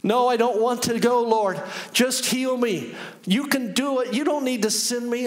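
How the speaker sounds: high, resisting plea to God; pleading prayer. Retold or imitated. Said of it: "And I'm praying, I'm like".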